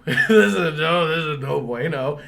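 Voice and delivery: high, loud laughing dread. Retold or imitated. Remembering the ghostly girl, laughing loudly in dread. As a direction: laugh it out in mock horror.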